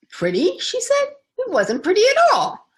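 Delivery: outraged, the word repeated back high; shocked disbelief. Acted. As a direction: pitch leaps high and loud in disbelief.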